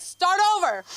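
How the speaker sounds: high, loud, firm command; firmly wipe the order clean; a high loud firm start over to the vendor; firm reset. Retold or imitated. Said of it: "I was just like, "Start over".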